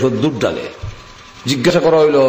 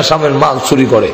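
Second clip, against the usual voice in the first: ordinary delivery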